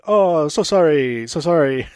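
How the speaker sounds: mock Larry David sarcastic sorry; sarcastic condescending sorry, Larry David style; lightly voiced Larry David apology. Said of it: "like Larry David going".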